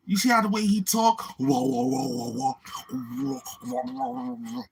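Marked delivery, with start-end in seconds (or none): 1.4-4.6 imitating an alien, squeaky whoas jumping high; mock alien warble, up high; hammed-up alien noise. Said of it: "That sound like an alien".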